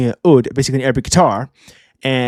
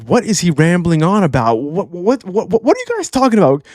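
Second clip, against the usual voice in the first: mock bewilderment climbing high; baffled Loki; 4 semitones higher than usual. Reviewer voicing Loki lost between two ramblers, baffled. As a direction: stammer on "what", pitch rising in disbelief. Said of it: "And then Loki's like".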